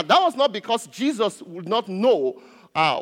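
normal talking voice

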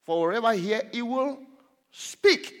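pause, then "speak" very high and loud; emphatic scripture reading. Real, not acted. Preacher repeating the verse to drive it home, emphatic. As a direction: rise through the phrase and hit "speak".

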